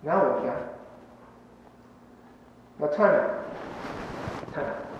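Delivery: hushed, slow aside; quiet time out. Real, not acted. Pastor stepping out of the sermon, hushed aside. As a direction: drop the voice and call a pause. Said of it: "This is my time".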